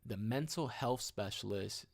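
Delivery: ordinary talk, nothing marked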